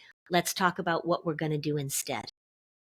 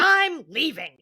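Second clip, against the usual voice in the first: a kid's defiant huff, voiced higher; mock defiant kid; 7 semitones higher, 5 dB louder, slower than usual. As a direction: play the sulky kid storming out. Kid storming off, acted quote.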